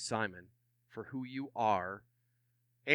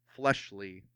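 ordinary delivery

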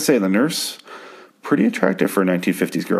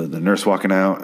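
ordinary talk, nothing marked

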